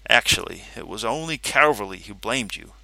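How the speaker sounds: ordinary delivery, nothing marked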